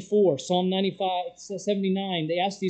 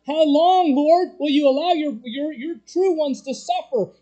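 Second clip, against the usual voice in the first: loud anguished cry to heaven; 9 semitones higher, 6 dB louder than usual. Retold or imitated. Angels crying out to the Lord, a loud plea. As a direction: call it out loud, pleading for justice.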